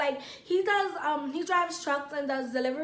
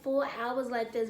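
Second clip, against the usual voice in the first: ordinary talk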